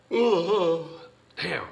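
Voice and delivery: sleepy yawn on Damn. Real, not acted. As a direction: let a yawn carry Damn. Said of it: "are you guys getting sleepy too".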